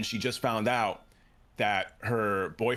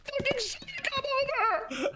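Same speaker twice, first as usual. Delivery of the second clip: mock screaming. Neighbor answering boyfriend on phone, mock screaming high. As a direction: imitate her hysterical scream, stretched out high.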